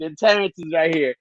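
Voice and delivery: usual speaking voice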